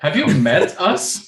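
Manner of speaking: words through laughter; laughing. Real, not acted. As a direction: laugh while saying it. Players laughing at themselves.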